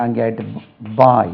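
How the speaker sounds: normal talking voice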